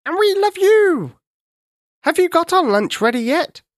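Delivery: a monkey teasing the king about lunch, high and chattery; chattering monkey. Acted. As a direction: chatter cheekily like a tiny monkey. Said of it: "A monkey chattered".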